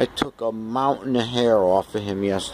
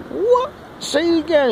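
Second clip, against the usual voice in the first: surprised. Retold or imitated. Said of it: "And I'm like".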